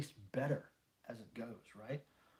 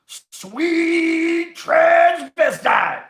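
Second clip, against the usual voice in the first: huge drawn-out shout, much higher and louder; full shout; 14 semitones higher, 19 dB louder, much slower than usual. Pretend. Streamer shouts a viewer's requested phrase, long and loud. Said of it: "You want me to shout Sweet Transvestite?".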